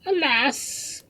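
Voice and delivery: very high-pitched lament; high wail of woe; lamenting cry. A narrator's reading. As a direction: cry out in dismay. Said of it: "cried Marianne".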